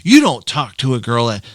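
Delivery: mock-angry parental telling-off; parents scolding. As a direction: scold the kid hard, punching the repeated "do not talk". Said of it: "my mom and dad freaked out".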